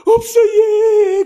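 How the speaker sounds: slow, high wailing sob on the words; sobbing child. Retold or imitated. Crying child answers her mother, slow high wail. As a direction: wail it out like a crying little girl. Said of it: "그러면서 막 우는 거예요".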